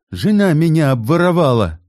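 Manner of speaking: high loud enraged yell. A narrator's reading. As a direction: roar the accusation. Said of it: "заорал Кит". (орёт)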